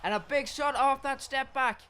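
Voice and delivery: high, loud play-by-play yell; excited shout. Real, not acted. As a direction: call the hit with a burst of excitement. Excited fight commentary.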